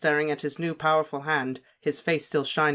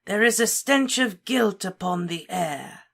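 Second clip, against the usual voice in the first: slow, higher villain voice, cold and menacing; Voldemort; 5 semitones higher than usual. A narrator's reading. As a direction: taunt the circle slowly, savouring each word. Voldemort accuses.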